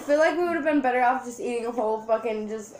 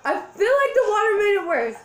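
loud distressed complaint; 8 semitones higher, 5 dB louder than usual. Real, not acted. Water made burn worse.

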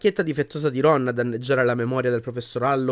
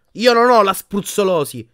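loud, raised protest; angry denial; 7 semitones higher, 7 dB louder than usual. A narrator's reading. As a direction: shout down an insistent healer. Boy angrily denies the diagnosis.

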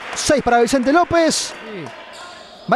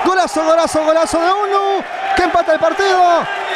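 higher shouted golazo call; goal shout; 5 semitones higher than usual. Acted. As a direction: belt out the equalizer. Equalizer celebrated.

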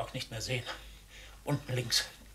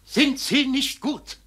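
angry outburst; 10 semitones higher, 11 dB louder than usual. Acted. Father flares up at his daughter.

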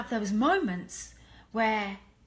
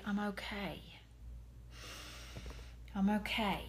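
whispered reassurance; 2 semitones lower, 9 dB quieter than usual. Shown as a demonstration. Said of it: "to always say".

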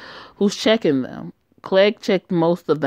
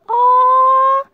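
very high, slow coo; soothing a crying little one in high baby talk; 3 dB louder, much slower than usual. Acted. Mother cooing to crying daughter.

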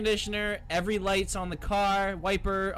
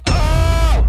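loud sudden yelp; startled yelp; 8 semitones higher, 16 dB louder than usual. Real, not acted. Player scared by a bang, loud startled yelp.

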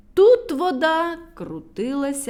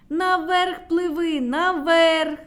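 high, loud, slow call; shouting; 6 semitones higher, 5 dB louder, slower than usual. A narrator's reading. The little ones shout to Burchun in the whirlpool. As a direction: yell him upward. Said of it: "кричали йому малюки".

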